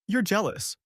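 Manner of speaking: high-pitched, scoffing accusation. A narrator's reading. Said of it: "she scoffed".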